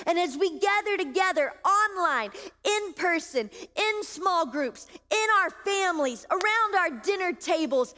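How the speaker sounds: ringing, emphatic sermon delivery; full-force preaching. Acted. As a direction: declare it boldly over the congregation.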